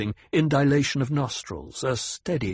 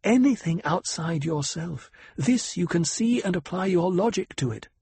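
higher, composed noble lady voice; lightly voiced commanding noblewoman; cool noble lady; 7 semitones higher than usual.

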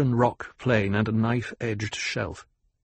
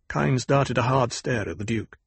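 ordinary delivery

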